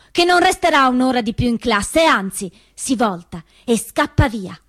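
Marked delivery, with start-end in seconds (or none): none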